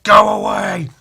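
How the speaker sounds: loud high bark; shouting. Retold or imitated. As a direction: yell to send him off. Dinosaur Batman shoos kid.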